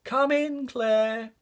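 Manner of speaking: high, carrying call of a grandma; grandma calling the girl in for dinner. Acted. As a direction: call the child in from across the yard like grandma. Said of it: "when my grandma calls".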